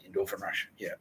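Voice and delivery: usual speaking voice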